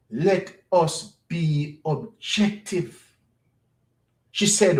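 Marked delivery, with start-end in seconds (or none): 0.1-3.2 each word set apart slowly; measured, emphatic repeat with gaps between words; slow, deliberate quote. Retold or imitated. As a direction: spell out "let us be objective" word by word.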